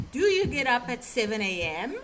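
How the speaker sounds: "Do you get up at seven A M?" with the voice rising at the end; rising question tune. Shown as a demonstration. Teacher modelling a yes/no question for students, rising tune. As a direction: lift the pitch on "A M". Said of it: "Your tone needs to go up at the end".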